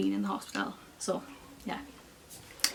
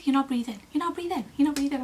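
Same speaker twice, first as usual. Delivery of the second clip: urgent coaching; 6 semitones higher, faster than usual. Retold or imitated. Midwife urging her to breathe.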